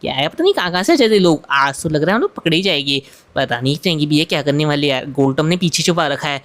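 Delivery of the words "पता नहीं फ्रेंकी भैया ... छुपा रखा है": usual speaking voice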